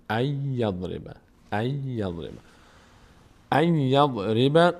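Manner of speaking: "ای یضرب" slowly with a held double y; merged Y. Shown as a demonstration. Teacher drills the correct merging, held Y. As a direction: linger on the y of "ای یضرب".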